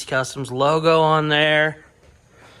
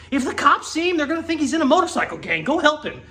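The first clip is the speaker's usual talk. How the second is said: high-pitched imitation of mom telling dad off; mock yell at much higher pitch, no real volume; 9 semitones higher, faster than usual. Mom yelling at dad to go help, retold mock yell. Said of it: "My mom starts yelling at him".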